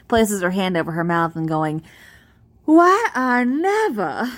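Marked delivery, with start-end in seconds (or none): 2.6-4.3 high, slow Southern belle affectation; delicate Southern belle. Acted. Girl playing along with country boy, mock Southern belle. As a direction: play the scandalized Southern belle, drawn out. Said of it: "pretends to be delicate Southern belle".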